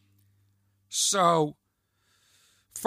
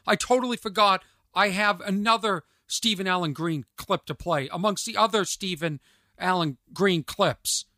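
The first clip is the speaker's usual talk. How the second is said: usual speaking voice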